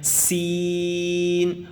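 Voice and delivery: سین held very long with a hiss; teacher chanting a long سین; whistled long letter. Shown as a demonstration. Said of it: "سیٹی کی آواز آتی ہے".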